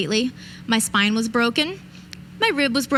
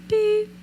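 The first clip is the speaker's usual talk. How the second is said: a high, light electronic beep; imitated beep; 10 semitones higher than usual. Speaker imitating the emergency beacon's little beep. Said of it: "a quiet little beep".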